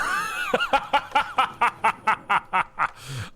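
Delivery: a long high burst of laughter; laughing. Real, not acted. Caster laughing hard at the player's trick. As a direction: crack up at the fake out.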